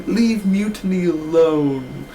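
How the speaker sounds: slow, whiny, corrected name; mocking Cameron. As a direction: drag out Mutiny. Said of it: "She's like".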